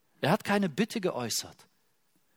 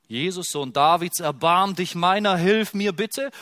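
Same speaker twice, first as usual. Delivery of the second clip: lauter Ruf, der gegen Ende höher wird; lauter Hilferuf; 3 semitones higher, 6 dB louder than usual. Retold or imitated. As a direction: ruf laut nach Jesus. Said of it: "der zu Jesus schreit". (der Blinde ruft Jesus laut um Hilfe an)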